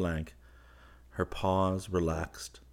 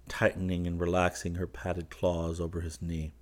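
ordinary delivery, nothing marked

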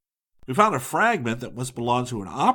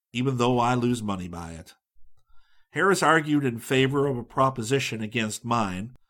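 ordinary delivery

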